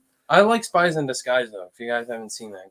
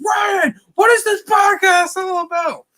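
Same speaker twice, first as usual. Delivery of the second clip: excited shout; 4 dB louder than usual. Real, not acted. Hyping up the podcast announcement.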